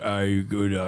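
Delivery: imitation British accent for a frog. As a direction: put on a hammy British accent. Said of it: "like, a British accent".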